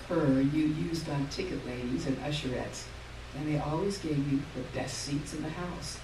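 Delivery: ordinary delivery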